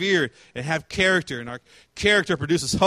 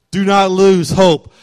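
slower and louder, pressing "lose"; emphatic louder repeat; 7 dB louder, slower than usual. Shown as a demonstration. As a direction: drive it home, slow and loud.

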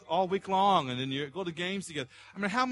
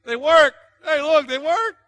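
much higher, loud, excited call; mock eager showing off; 11 semitones higher, 13 dB louder than usual. Pastor playing a rescuer showing the ropes, loud eager call. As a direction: wave the rope proudly. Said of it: "and I said".

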